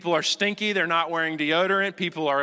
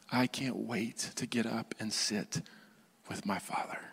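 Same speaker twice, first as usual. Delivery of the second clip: hushed longing; 5 semitones lower, 11 dB quieter than usual. Acted. Pastor imagining waking to meet his Father, hushed. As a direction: drop to an intimate near-whisper. Acting out eager morning with God.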